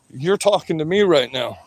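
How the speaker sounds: ordinary talk, nothing marked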